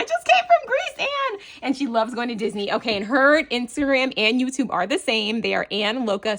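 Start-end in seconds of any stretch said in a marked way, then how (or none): 0.0-1.4 high, louder squeal of coincidence; much higher, thrilled; excited squeal. Real, not acted. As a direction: squeal it at the friend. Excited coincidence about dream trip.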